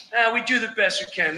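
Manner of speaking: ordinary talk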